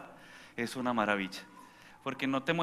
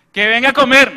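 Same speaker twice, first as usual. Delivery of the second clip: loud, high shout to come eat; yelled call; 8 semitones higher, 18 dB louder than usual. Retold or imitated. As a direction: holler from the kitchen. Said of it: "No, ir a la habitación".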